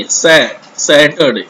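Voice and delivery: slow Saturday, sa sounded alone first; first syllable drill. Shown as a demonstration. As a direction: isolate the open sa, then say Saturday slowly. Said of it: "एस ए". (पहले 'सै', फिर धीरे से Saturday)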